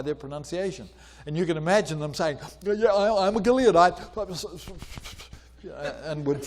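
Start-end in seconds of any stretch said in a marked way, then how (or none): 2.6-4.0 eager mock claim. Imagined fugitives claiming to belong.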